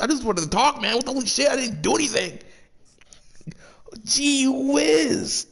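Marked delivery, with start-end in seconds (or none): 4.0-5.5 high breathy whisper of gee whiz; mock gee whiz. Mocking juror's phrase.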